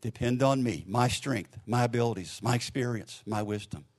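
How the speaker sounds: soft, low reassurance, phrase by phrase; very gentle voice. Retold or imitated. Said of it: "with a very gentle voice saying".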